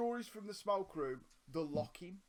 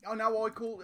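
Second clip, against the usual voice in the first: ordinary talk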